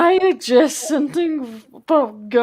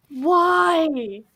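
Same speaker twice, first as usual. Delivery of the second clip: ordinary delivery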